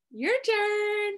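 sing-song invitation. Shown as a demonstration. Calls children to join in.

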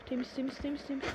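quietly confirming the area is clear; hushed checking. Real, not acted. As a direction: murmur it while sneaking around. Sneaking, checking the room is clear.

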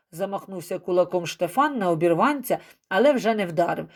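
ordinary delivery, nothing marked

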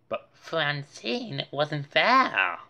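ordinary delivery

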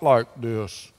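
put-on deep voice, dropping downward. Shown as a demonstration. Pastor asking children if Jesus talked differently, mock deep voice. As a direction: sink into a pretend deep voice. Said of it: "if it was a deep voice".

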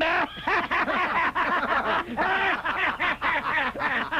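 long high cackling laugh; evil laugh. Acted. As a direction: cackle over the threat. Caliph after threat.

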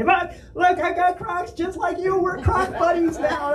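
very high, mock-excited fan voice; gushing fan. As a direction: imitate an over-eager passenger showing off. Imitates eager passengers running up.

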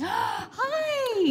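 very high, drawn-out hi; high-pitched shout of greeting; excited greeting. Real, not acted. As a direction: squeal a delighted hello. Greeting viewers in the Netherlands.

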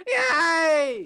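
very high, loud mock cheer; over-the-top squealed imitation; imitated gleeful yay. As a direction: shriek it like a copied catchphrase. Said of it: "the one that was like".

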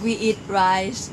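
ordinary talk

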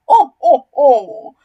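very loud, hammed-up crocodile "oh"s; mock crying out. A narrator's reading. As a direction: cry out in big theatrical bursts. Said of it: "cried the enormous crocodile".

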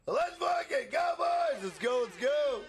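high excited yell of triumph; pumped streamer hype call; hype shout. Real, not acted. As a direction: cheer the chat on at full pitch. Hype cheer about emoji.

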